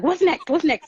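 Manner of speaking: high, eager repeated question; retold excitement. Friend hearing I am a student, all excited. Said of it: "So now she's all excited".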